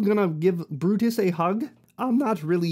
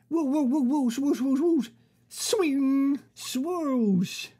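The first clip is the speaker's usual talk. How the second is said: whooshing lasso noises; 5 semitones higher than usual. Acted. Lasso sound effects.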